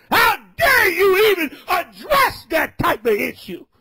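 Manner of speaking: shouted, high street sermon; fiery preaching. Acted. Street preacher scolding a brother, shouted preaching. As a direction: preach it full force at the crowd.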